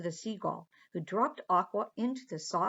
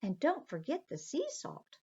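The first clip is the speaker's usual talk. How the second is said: high, sweet little mermaid; light, dainty sea creature voice; 7 semitones higher than usual. Acted. Aqua orders lunch.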